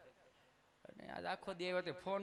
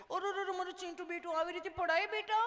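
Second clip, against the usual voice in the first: put-on high, loud doting baby talk; cooing mother; 12 semitones higher, 5 dB louder than usual. Doting mother consoling her fallen son, in high cooing baby talk. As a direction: fuss over the child like a doting mummy.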